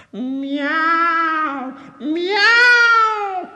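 very high, loud drawn-out meows; cats crying together. A narrator's reading. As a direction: wail piercingly like approaching cats. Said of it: "crying all together".

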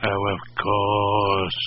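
very slow, low, softened purr; understanding purr. A narrator's reading. Bambi catching on that he can't talk, purring. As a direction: draw it out knowingly. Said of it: "Bambi purred understandingly".

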